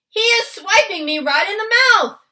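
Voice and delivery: high, loud outraged giant; yelling. A narrator's reading. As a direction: yell as if just punched awake. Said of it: "yelled the meat dripper".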